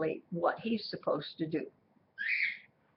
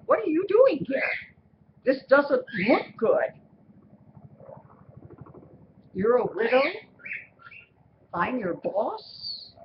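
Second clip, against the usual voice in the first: a much higher, louder startled man waking up; startled Boaz; 6 semitones higher, 5 dB louder than usual. Retold or imitated. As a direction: jolt awake and demand loudly what she is doing there. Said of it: "He's gonna say".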